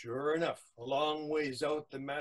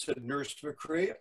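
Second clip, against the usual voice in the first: usual speaking voice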